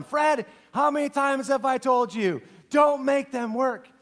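mild retold scolding; scolding wife. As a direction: tell him off like an exasperated wife. Susie scolding Fred.

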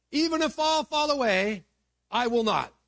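high, loud, insistent Peter; emphatic declaration. Acted. Peter swears loyalty to Jesus at the last meal, emphatic. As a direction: declare it forcefully, pitched right up. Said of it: "Peter insisted emphatically".

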